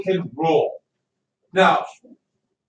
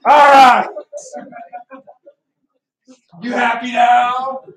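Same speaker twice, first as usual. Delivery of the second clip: mock outburst; 6 semitones higher, 6 dB louder, slower than usual. Teacher fake-upset at mocking class.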